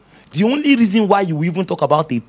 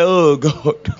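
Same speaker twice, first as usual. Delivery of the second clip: sighing exasperation; 3 semitones lower than usual. Acted. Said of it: "the Holy Ghost say".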